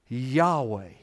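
normal talking voice

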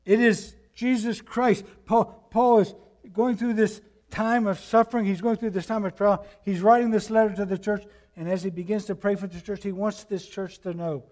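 fervent pulpit climax; preaching at full force. Acted. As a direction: drive the point to the congregation. Climactic sermon point.

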